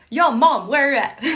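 loud laughing "Yo, Mom"; calling out to mom, loud and laughing; casual Hamlet. Acted. Hamlet entering the chamber, calling loudly. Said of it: "Hamlet ... is like".

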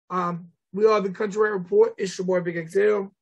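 ordinary talk, nothing marked

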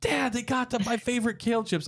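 dad retelling his son's delight at kale chips, high and eager; excited teen son. Retold or imitated. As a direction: gush like a thrilled thirteen-year-old. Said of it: "But my son was like".

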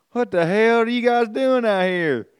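put-on high, loud local Michigan accent; calling out in a thick Michigan accent. Boat guy calling out to stranded sailors, loud in a Michigan accent. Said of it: "everyone down in that area has got some sort of an accent".

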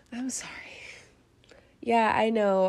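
ordinary talk, nothing marked

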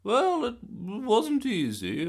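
high, loud, slow granny; innocent Gran voice. A narrator's reading. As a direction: play dumb sweetly.

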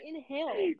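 protesting through laughter; laughing too much to finish. Real, not acted. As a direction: get it out while cracking up. Laughing denial of yawning.

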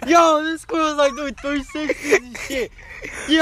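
very high, loud amazed yelling; excited shouting. Real, not acted. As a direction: scream it in pure amazement. Squirrel doing three sixties.

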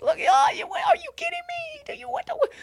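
very high, disbelieving squeal; can't believe his eyes, voice at the top; stunned squeal. Real, not acted. Disbelief at the art quality.

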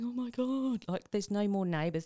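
high-pitched retold wail of dismay; mock tearful. Host tells guest of watching the last episode, mock tearful. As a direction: lament it in a high voice, imitating tears. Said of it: "I was just in tears going".

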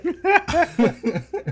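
loud, high burst of real laughter; big laugh. Real, not acted. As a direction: crack up at the punchline. Laughs at own story.

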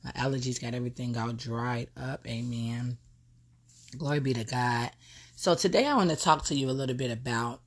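talking around a cough drop; soft, with a cough drop in the mouth; sucking a cough drop. Real, not acted. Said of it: "put a cough drop in my mouth".

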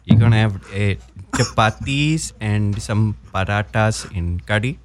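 Indian parent listing the dinner dishes; Indian accent. Acted. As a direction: answer as a dad with an Indian accent. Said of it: "Your standard Indian accent".

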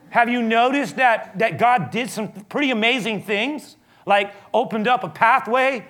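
high, loud protest, fed up; exasperated Moses. Retold or imitated. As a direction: throw it back at the accusers in frustration. Moses defending himself before accusers.

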